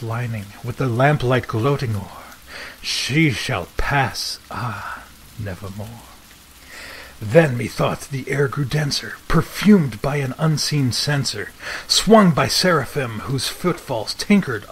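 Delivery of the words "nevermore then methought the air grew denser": ordinary delivery, nothing marked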